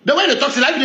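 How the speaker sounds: ordinary talk, nothing marked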